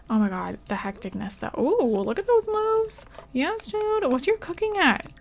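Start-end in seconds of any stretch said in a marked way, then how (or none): 1.6-5.1 high-pitched playful hype; squeaky, drawn-out mock admiration; playful cheering. Playfully cheering game character's moves.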